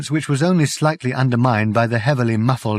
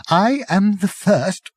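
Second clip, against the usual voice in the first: slow, halting Arthur; 10 semitones higher, slower than usual. A narrator's reading.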